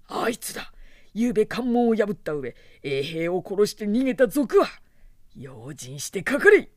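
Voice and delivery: loud officer barking orders; booming commanding warlord. A narrator's reading. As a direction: command the soldiers, loud and urgent. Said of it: "兵へにわかに命令した".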